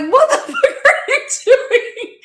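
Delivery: squealing high through laughter; shocked laugh as you ask; laughing disbelief. Retold or imitated. Shocked at friend pulling guns, laughing high squeal.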